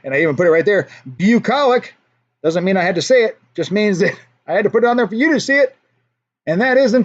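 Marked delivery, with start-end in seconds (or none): 1.1-1.9 "Bucolic" repeated as "pyoo-KOL-ik"; actual pronunciation. Shown as a demonstration. Host repeating the corrected word for viewers, "Bucolic" with the "yoo" sound. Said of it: "is the actual pronunciation".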